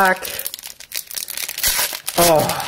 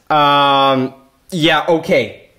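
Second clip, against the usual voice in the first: ordinary talk, nothing marked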